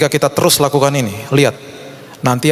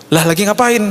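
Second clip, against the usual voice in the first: lightly imitated friend, pitched higher; nosy question in a higher voice; curious visiting friend; 7 semitones higher than usual. Wife's friend dropping by, lightly imitated curious question. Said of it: "Dia sahabat istri saya".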